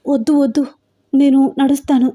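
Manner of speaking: mildly acted anxious protest; anxious refusal. A narrator's reading. Frightened heroine refuses to be carried.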